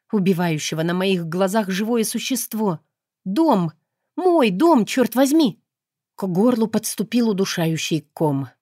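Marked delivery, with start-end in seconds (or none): 3.2-5.6 higher, louder desperate outcry; anguished cry. Acted. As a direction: shout for the burning house. Heroine watches her house burning.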